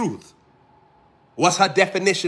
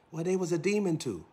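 ordinary talk

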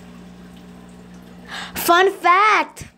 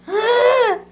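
crying gasp; 9 semitones higher than usual. Acted. Dramatic cry gasp.

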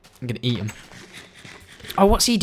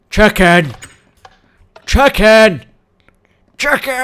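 louder calls of chicken; mock calling; 5 semitones higher, 6 dB louder, slower than usual. Gamer calling out for his lost chicken, mock dramatic.

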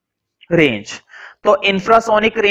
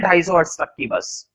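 ordinary talk, nothing marked

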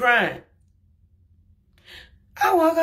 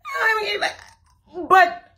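laughing through tears; 13 semitones higher, 4 dB louder than usual. Real, not acted. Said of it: "laugh/cry".

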